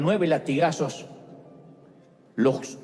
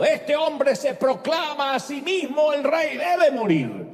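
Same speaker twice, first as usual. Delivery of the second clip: high, raised cry for his death; accusing shout; 10 semitones higher, 3 dB louder than usual. Acted. Religious leaders shout against the accused before Pilate, high accusing cry. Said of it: "empiezan a gritar contra el acusado".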